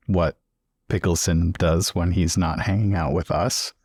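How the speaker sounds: ordinary talk, nothing marked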